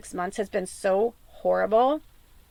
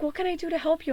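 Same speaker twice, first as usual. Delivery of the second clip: soft, higher-pitched pet talk; tender coaxing; 6 semitones higher, 5 dB quieter, faster than usual. Retold or imitated. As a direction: ask gently, like soothing an old cat. Coaxing her hurting old cat.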